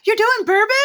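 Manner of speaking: high, loud, shocked "You're doing bourbon?"; shocked question. Retold or imitated. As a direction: gasp it out in disbelief. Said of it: "I had people go".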